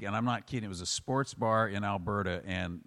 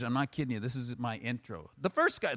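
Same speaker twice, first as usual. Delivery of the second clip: usual speaking voice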